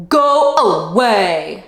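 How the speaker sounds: a hint of cranky shouting bug; grouchy shout. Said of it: "shouted the grouchy ladybug".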